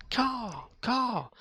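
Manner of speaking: high crow caw, cawing up at them; crow caw imitation. Man cawing back at crows at work, high crow imitation. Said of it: "So I'll go".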